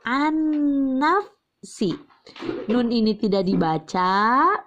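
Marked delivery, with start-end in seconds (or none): 0.1-2.0 "an-nafsi" said slowly with the nun mati skipped into a held nn; merged reading demo. Shown as a demonstration. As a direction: glide straight into the double n. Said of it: "Loncat langsung". ("an-nafsi" dibaca lambat, nun langsung lebur)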